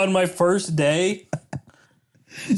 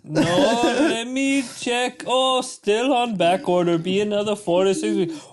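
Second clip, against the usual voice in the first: higher, slow, overly polite agent voice; mock call-center agent; 6 semitones higher than usual. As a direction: drawl out the agent's reply in a higher voice.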